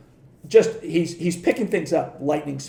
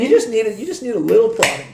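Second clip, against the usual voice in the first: higher, louder teasing advice; mock pep talk; 6 semitones higher, 5 dB louder than usual. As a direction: rib him like a proud mentor. Teasing Kelly about his win.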